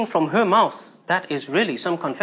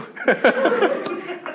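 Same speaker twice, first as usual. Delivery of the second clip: high laugh; 11 semitones higher than usual. Real, not acted. Laughing at her insulting pastor.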